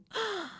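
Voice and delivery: sharp, high intake of breath; horrified gasp. A narrator's reading. Narrator imagines the pot being lifted, horrified gasp. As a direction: gasp at a narrow escape. Horror at being nearly found.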